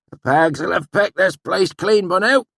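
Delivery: put-on high, loud old country voice; rustic swineherd. A narrator's reading. Swineherd warning the narrator, high and loud. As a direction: play the swineherd warning a stranger. Sheem ready to leave.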